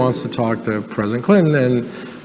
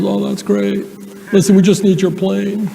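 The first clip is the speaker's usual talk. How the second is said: higher Clinton voice, casual ask; Bill Clinton impression; 7 semitones higher than usual. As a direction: drop the favour like it's nothing, as Clinton. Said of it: "I can do his voice really well".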